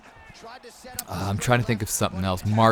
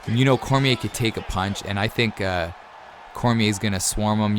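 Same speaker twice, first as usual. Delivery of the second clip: usual speaking voice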